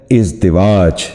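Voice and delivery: ازدواج as iz-di-waaj, "i" in both short syllables; correct pronunciation. Shown as a demonstration. Teacher repeating for viewers the correct ازدواج, iz-di-waaj. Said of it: "درست تلفظ ہے".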